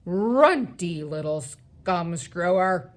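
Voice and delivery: loud, slow giant name-calling; booming giant abuse; shouted insult. A narrator's reading. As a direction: hurl the insult, drawing it out. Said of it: "he shouted".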